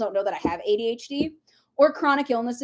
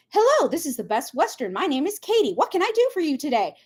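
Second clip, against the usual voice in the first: lilting, higher customer-service voice; singsong scripted front-desk hello; receptionist greeting; 4 semitones higher than usual. Acted. Hotel receptionist answering the business phone, scripted friendly greeting. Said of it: "When you answer a business phone".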